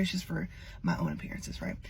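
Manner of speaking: soft whispered confession; breathy secret; whispered aside. Real, not acted. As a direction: whisper it to the camera. Aside about her toes.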